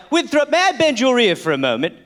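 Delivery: mock old-fashioned British accent; old British gentleman. One colonist approaching another with an idea, mock British accent. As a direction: play a stuffy colonial Englishman.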